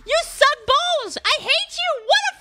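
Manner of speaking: high, loud screaming at the game; raging screams at a bad gacha pull; furious rant. Real, not acted. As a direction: yell with real rage at the pull. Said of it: "I'm so mad right now".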